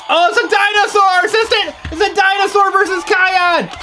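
high-pitched play-by-play excitement; excited battle commentary. Pretend. Announcing a dinosaur versus Kion to assistant, excited. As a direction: call the toy fight like a big event.

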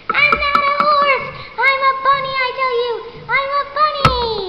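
high, offended squeak of protest; indignant protest. Acted. Ridden bunny objects, high offended squeak. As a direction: insist you are a bunny, high and cross.

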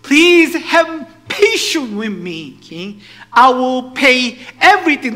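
high, booming plea drawn out slowly; loud pleading. Acted. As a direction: beg the king at full volume. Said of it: "pleading with him".

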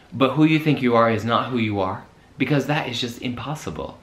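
usual speaking voice

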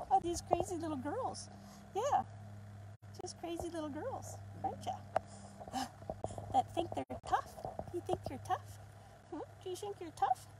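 put-on high, sing-song baby talk to puppies; talking to the puppies in a high cooing voice; puppy talk. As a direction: coo at the puppies like they're toddlers. Teasing the puppies.

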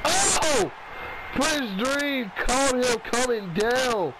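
lively ringside play-by-play; wrestling commentator. Acted. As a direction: call the match as it happens. Live play-by-play of the match.

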